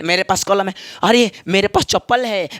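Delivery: ordinary talk, nothing marked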